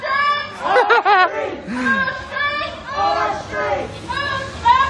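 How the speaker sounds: long, high cackling laugh; drawn-out high laughter; high-pitched laughing. Real, not acted. Laughing at his own insult.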